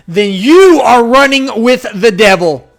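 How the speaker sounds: slow, loud, high preacher-like warning; loud declaration. Real, not acted. Ranting to his viewers, slow booming warning. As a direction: thunder it out like a preacher. Ranting against tolerating leftists.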